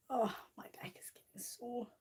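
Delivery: softly whispered aside about an aching back; whispered complaint. Real, not acted. Whispering to viewers about her sore back. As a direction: whisper it as a private grumble.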